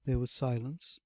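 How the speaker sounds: usual speaking voice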